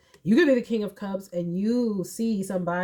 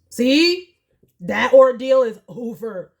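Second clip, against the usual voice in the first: loud, thrilled and pitched up; excited exclaim; 5 semitones higher, 9 dB louder than usual. Real, not acted. To the viewer about the ordeal ending, loud and thrilled. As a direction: shout it out like good news.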